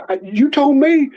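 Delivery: high-pitched mock indignation; retold protest. Blamed boy to his friend, indignant protest.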